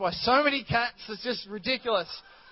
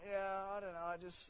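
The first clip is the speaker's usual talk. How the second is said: much softer, half-hearted shrug; mock unsure mumble; 14 dB quieter than usual. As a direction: let it trail off, unconvinced. Unsure churchgoer voiced.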